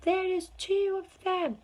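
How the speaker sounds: high, loud, slow shout; children shouting in amazement. A narrator's reading. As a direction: yell it across the river. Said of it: "started shouting at once".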